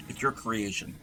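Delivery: ordinary talk, nothing marked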